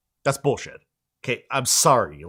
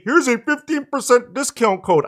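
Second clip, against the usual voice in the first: high mocking cartoon voice, pitched way up; failed SpongeBob voice; 11 semitones higher than usual. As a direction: sneer it in a squeaky cartoon voice. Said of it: "I can't do the SpongeBob voice".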